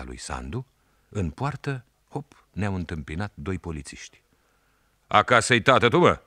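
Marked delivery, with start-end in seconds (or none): 5.1-6.2 policeman putting on a falsely friendly tone, a bit higher and louder; sly fake-kind question to the boy; put-on friendly policeman voice; feigned voice. A narrator's reading. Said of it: "a întrebat unul cu glas prefăcut".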